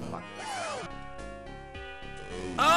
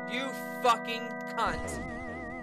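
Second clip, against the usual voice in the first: low, slow, quiet curse; seething; 5 semitones lower, 7 dB quieter, slower than usual. Real, not acted. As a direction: mutter it through your teeth.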